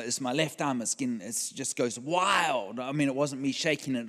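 ordinary delivery

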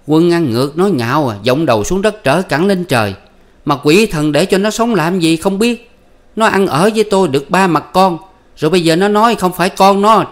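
high, loud shrieking curses; wife cursing her husband, high and loud; furious wife. Acted. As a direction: wail to heaven at the insult, pitch way up. Wife voiced screaming curses.

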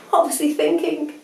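usual speaking voice

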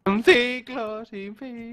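high, slow announcer-style intone; dramatic slow intone. Pretend. Friend teasing a buddy's mood cycles, high and slow. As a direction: draw it out like a movie trailer.

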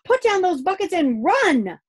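loud, high call to hurry; urgent shouted order. A narrator's reading. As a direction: shout it as an emergency command. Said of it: "shouted Grumble".

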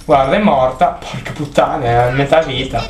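ordinary talk

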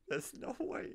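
breathy high laughing words; laughing disbelief. Real, not acted. Gamer laughing in disbelief at the hidden sentry, breathy laugh.

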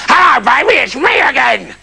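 hammed-up beggar hello. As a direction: slip back into the beggar's voice. King redoes beggar voice.

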